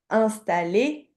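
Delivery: «installé» dit très lentement, syllabe par syllabe; répétition lente. Shown as a demonstration. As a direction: articule «installé» lentement pour l'élève. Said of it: "Et je te répète". (prononciation lente et appuyée)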